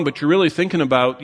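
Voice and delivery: normal talking voice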